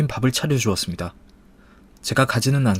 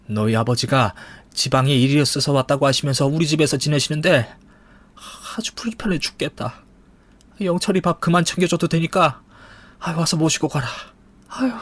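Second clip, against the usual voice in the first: higher-pitched, fed-up mother-in-law; exasperated mother-in-law; 4 semitones higher than usual. Acted. Mother-in-law asking her to fetch her father, exasperated. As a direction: complain as if at wit's end.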